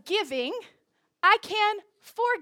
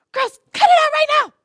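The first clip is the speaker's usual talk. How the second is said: high, full-force parental yell; re-enacted yell of a fed-up parent; 10 semitones higher, 15 dB louder than usual. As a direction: holler at the squabbling kids. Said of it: "because I'm yelling at them".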